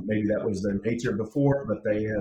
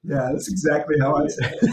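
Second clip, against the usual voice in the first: higher, louder playful brag; playful bragging through a grin; joking; 4 semitones higher, 6 dB louder than usual. Real, not acted. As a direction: tease the other host. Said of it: "jokingly claims the line".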